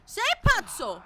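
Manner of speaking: hammed-up shout. Louder repeat of the line.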